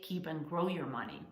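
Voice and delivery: usual speaking voice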